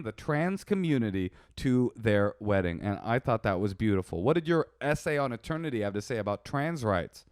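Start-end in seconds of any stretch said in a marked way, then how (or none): none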